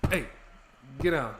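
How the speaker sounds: normal talking voice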